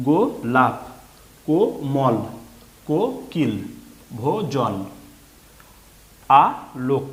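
each word in two distinct beats, গো-লাপ, কো-মল; broken into syllables. Shown as a demonstration. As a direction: model গোলাপ and কোমল syllable by syllable for beginners. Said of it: "ভেঙে ভেঙে উচ্চারণ করে".

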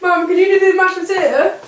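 calling out. Real, not acted. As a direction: call it out to Mum so she hears. Calling to Mum in another room.

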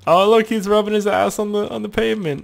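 high, cutesy delight; mock cooing. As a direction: coo high as if at a cute pet. Coos at goat rubbing itself.

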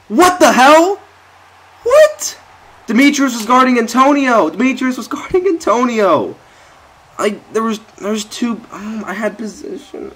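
very high, loud shrieking protest; real indignant shriek; outraged disbelief. Real, not acted. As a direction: shriek at the injustice. Defender blew the coverage.

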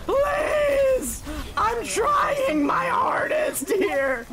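drawn-out, mock-desperate shout; wailing plea. Player begging the team mid-game, wailing plea. Pleads loudly during the match.

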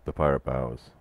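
usual speaking voice

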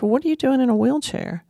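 a hint of a curious small child asking; little girl's voice. Little girl quoted in a dream.

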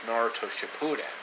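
ordinary delivery

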